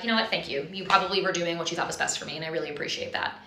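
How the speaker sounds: soft, quiet, fawning thanks; meek gratitude. Retold or imitated. Wife imitating her starry-eyed self thanking husband, soft and meek. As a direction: gush thanks softly, a little too meek. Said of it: "and I'd be like".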